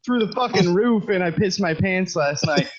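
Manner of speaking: ordinary delivery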